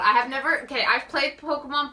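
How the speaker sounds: ordinary talk, nothing marked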